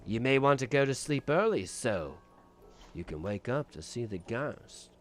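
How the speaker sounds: low, soft, ominous; spooky butler warning the guest; mock spooky host. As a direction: hush it like a creepy butler. Host voice dropping low and quiet.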